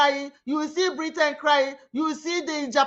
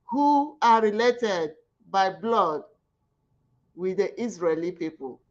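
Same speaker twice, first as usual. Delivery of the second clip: normal talking voice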